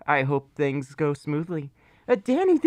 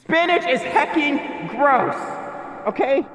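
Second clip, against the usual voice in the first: mock godlike declaration; 7 semitones higher than usual. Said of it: "I had to become a god for a second".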